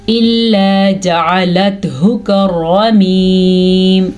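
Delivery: slow recitation, heavy r in "كَالرَّمِيمِ"; measured Quranic recitation. Shown as a demonstration. Teacher reciting for learners, "كَالرَّمِيمِ" slow with full r. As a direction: recite slowly, holding the ī of "كَالرَّمِيمِ". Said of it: "را کو پر پڑھنا ہے".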